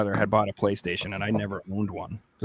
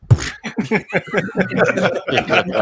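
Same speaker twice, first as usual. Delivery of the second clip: laughing; 6 semitones higher, 5 dB louder than usual. Real, not acted. Laughing at own story.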